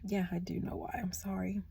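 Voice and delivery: soft, near-whispered apology, choked up; emotional apology. Real, not acted. Reader apologising to viewers, softly moved. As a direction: apologise quietly, fighting tears. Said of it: "I feel emotional about that".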